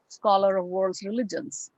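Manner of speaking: ordinary talk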